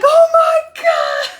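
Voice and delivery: high, loud, slow squeal through tears; overjoyed cry for downline win; laughing-crying shriek. Real, not acted. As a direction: let it burst out, laughing and crying. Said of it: "I was like".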